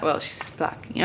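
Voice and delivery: unvoiced whispered aside. Real, not acted.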